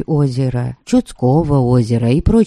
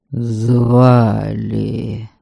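low, booming spirit voice; echoing ethereal energy being; низкий гулкий голос; 5 semitones lower than usual. A narrator's reading. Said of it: "Теперь он говорил низким, гулким голосом". (глухо и гулко, как нечисть из тьмы)

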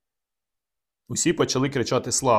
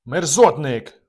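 loud, furious insult; angry outburst with raised volume; enraged exclamation; 3 semitones higher, 6 dB louder, much slower than usual. A narrator's reading. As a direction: hurl it out in a rising rage. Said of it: "вигукнув я шаліючи".

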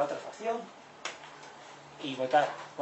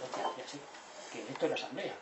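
whispered realization; 8 dB quieter than usual. Retold or imitated. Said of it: "Luego dicen".

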